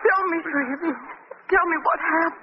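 high, pleading, dreading the news; desperate pleading. Acted. Miss Lane begs Shrevey for the news, high and desperate. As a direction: beg him to tell you, fearing the worst.

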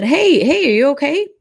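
calling out loud. Retold or imitated. Calling to slumped driver.

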